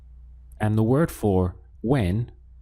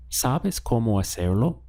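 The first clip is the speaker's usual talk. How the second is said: "Sabes cómo hacerlo" turned into a question with a rise at the end; question intonation. Shown as a demonstration. Spanish teacher shows learners how to ask by tune alone, question intonation. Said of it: "use the intonation of your voice".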